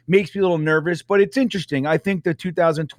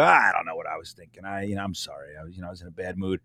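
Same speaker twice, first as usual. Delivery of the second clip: sheepish mumbled apology; 10 semitones lower, 8 dB quieter, faster than usual. Retold or imitated. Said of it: "and you go".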